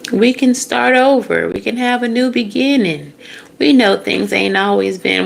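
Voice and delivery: put-on high pleading country accent. Family begging for a new beginning, high country accent. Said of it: "I don't know why it's a country accent".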